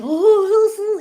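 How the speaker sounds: high, loud drawn-out "oh"; mock squirming. Speaker acts out struggling to receive, mock squirming. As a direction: squirm out loud at being given something.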